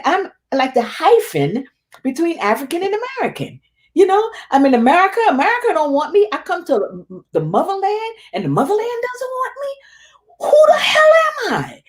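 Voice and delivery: high, tearful lament building to a crying shout; retold crying outburst. Telling the host how she broke down in that workshop, building to a crying shout. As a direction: let the pain rise until it breaks into a cry. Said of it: "And I'm just crying".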